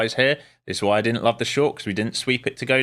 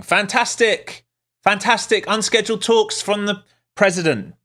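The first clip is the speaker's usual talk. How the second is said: sarcastic excitement; 11 semitones higher, 4 dB louder than usual. Real, not acted. Sarcastic reaction to news.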